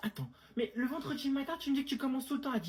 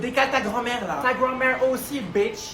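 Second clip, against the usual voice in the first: loud, furious ranting; a Parisian raging at tourists blocking the way; angry shouting; 8 dB louder than usual. Acted. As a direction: yell insults non-stop. Metro argument with tourists.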